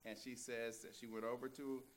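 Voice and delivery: ordinary delivery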